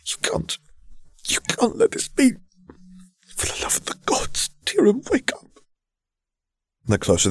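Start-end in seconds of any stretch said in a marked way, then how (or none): 0.1-5.6 high, whispered, near-tears pleading; desperate young prince. Acted. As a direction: beg him to wake up. Prince voiced begging dead friend.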